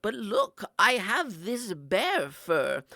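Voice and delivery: put-on loud, slow proud old lady; feisty grandma. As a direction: show off the trophy. Grandma showing fur.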